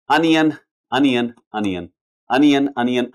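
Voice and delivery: teacher drills अनियन for students, clear and repeated; drilled pronunciation. Shown as a demonstration. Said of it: "यहां पे देखिए अनियन".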